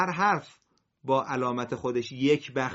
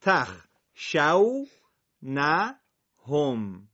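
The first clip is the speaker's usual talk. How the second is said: slow correct syllables; much slower than usual. Shown as a demonstration. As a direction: split each syllable carefully. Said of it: "باید مواظب باشیم".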